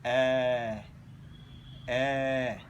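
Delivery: long, low "A", mouth open; drawn-out, open teaching "A"; open vowel demonstration. Shown as a demonstration. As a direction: hold each "A" steadily. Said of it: "Now I will pronounce".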